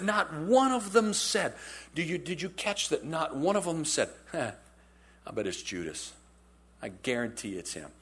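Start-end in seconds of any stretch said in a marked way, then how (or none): 4.3-7.9 gossiping aside. Retold or imitated. Disciples gossiping about Judas.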